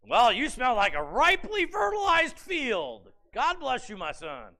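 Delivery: lightly voiced blind father, fond and amused; old Isaac voiced. Old Isaac sniffing his son, lightly voiced with fond praise. Isaac blessing Jacob by smell.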